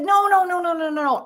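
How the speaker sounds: rapid-fire high-pitched string of no's; hurried corrective protest, pitched well up; flustered protest. Retold or imitated. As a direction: cut them off with urgent, repeated objections. Correcting the misheard room number, flustered.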